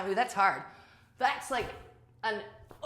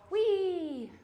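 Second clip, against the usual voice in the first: playful whee; 12 semitones higher than usual. Real, not acted. Playful during exercise.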